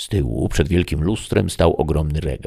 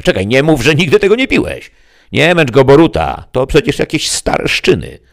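very high, loud devil scoffing; a demon laughing off the old mead; mocking devil; 10 semitones higher, 8 dB louder than usual. (diabeł kpi z miodu, bardzo wysoko i głośno)

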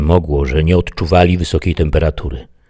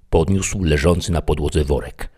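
ordinary delivery, nothing marked

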